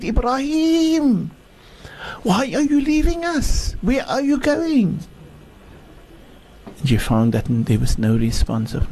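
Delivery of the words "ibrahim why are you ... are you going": desperate shout. Acted. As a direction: call out to him once more, pleading. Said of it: "she shouted and she said".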